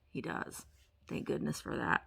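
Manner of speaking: soft whisper to herself; whispered aside. Real, not acted. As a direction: breathe it out as a private comment. Aside while reading card.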